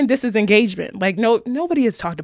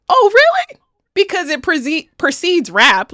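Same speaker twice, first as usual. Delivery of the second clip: sarcastic retort; 8 semitones higher, slower than usual. Real, not acted. Host mocking British commenters, sarcastic. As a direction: scoff at the excuse.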